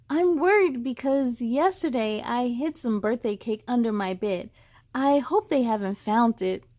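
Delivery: expressive picture-book reading; story reading. Acted. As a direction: read to children, lively. Reads the story text.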